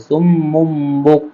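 সুম্মুম বুক slowly, the double m hummed; slow recitation. Shown as a demonstration. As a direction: stretch সুম্মুম বুক with ghunna. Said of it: "গুণনা করতে হবে।". (ধীরে সুম্মুম বুক)

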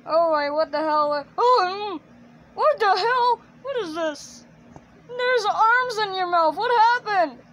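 high, loud hammed-up alarm; shocked doctor. Doctor finding arms in Jeffy's mouth, loud mock shock. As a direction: build into a high, exclaiming panic. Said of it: "doctors go like".

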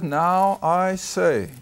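slow, deliberate reading; weighty, unhurried reading of the verse; slow scripture reading. Shown as a demonstration. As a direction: lay each word down slowly. Reading scripture slowly, word by word.

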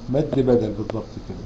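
ordinary delivery

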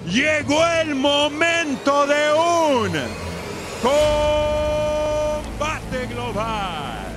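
long drawn-out ring announcer call; lucha libre announcer. Acted. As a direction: stretch every syllable, booming.

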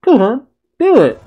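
high, loud, punchy goading; goading Thawne impression. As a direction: imitate a villain egging someone on, high-pitched. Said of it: "And Thawne's just like".

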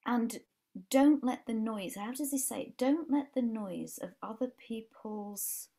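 ordinary talk

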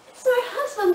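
upset wife. Acted. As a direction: blurt it out, hurt and high. Distraught wife confides in therapist.

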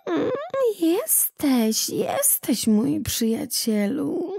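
high, purring cat's greeting, drawn out; joyful meowing cat. A narrator's reading. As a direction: meow it out as a delighted cat. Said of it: "radosne miauczenie".

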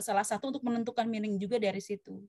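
ordinary talk, nothing marked

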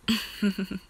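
short closed-mouth snicker; quick heh-heh-heh; chuckle. A narrator's reading. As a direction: snicker briefly after a tease. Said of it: "Kilwa chuckled".

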